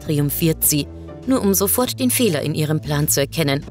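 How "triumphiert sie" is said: plain reading voice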